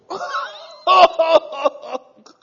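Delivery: high, loud shouted laugh. Real, not acted.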